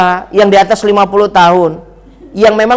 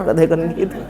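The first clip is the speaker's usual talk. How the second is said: ordinary talk, nothing marked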